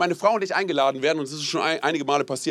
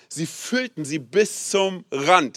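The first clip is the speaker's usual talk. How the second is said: slow, weighty reading; much slower than usual. Shown as a demonstration. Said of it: "Sag mal bitte, „bis zum Rand".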